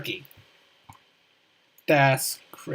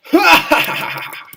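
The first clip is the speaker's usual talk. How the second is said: loud, high evil cackle; sneering panto villain laugh; 10 semitones higher, 9 dB louder than usual. Acted.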